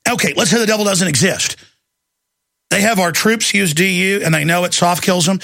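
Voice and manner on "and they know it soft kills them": ordinary talk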